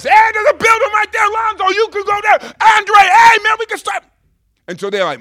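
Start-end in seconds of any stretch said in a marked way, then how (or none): 0.0-4.0 high, very loud hollering; excited calling out. Retold or imitated. Young believer pestering friends at every stop, excited calling out. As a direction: yell to your friends as if spotting treasure.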